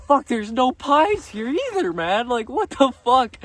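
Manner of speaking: drawn-out annoyed complaint; exasperated. Retold or imitated. Retold complaint at Costco.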